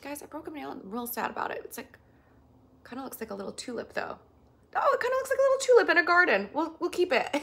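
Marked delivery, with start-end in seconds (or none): 4.8-6.5 put-on high, loud, fast baby talk; squeaky, hurried delight; cutesy gushing. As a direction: coo excitedly with a laugh in it. Cutesy replay of the tulip remark.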